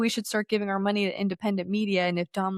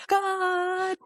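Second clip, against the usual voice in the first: worshipper's cry; 11 semitones higher than usual. Retold or imitated. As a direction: belt it out high like a fervent worshipper. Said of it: "and then you hear".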